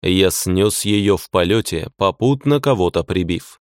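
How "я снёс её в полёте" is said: plain reading voice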